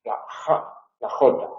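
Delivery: la J as a rough whispered fricative; voiceless J. Shown as a demonstration. Teacher demonstrating the J fricative. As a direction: breathe the J out voiceless. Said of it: "sorda fricativa".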